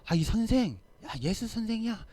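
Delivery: high, urgent cry of a panicked disciple; urgent call. Retold or imitated. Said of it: "조금 다급하게". (다급하게 부르는 높은 목소리)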